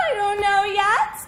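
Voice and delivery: ordinary talk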